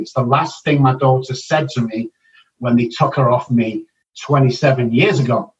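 ordinary talk

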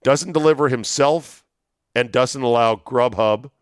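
normal talking voice

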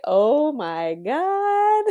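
high, drawn-out gasp of disbelief; shocked oh my God. Retold or imitated. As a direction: stretch it out in mock horror. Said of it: "you were like".

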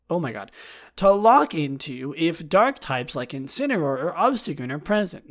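normal talking voice

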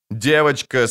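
ordinary delivery, nothing marked

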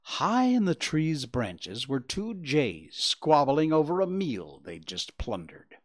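usual speaking voice

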